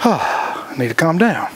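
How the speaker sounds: a slow sigh and a self-calming line; slow, sighing self-talk to settle down. Shown as a demonstration. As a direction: breathe out, then say it slowly to yourself. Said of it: "then you gotta take a deep breath".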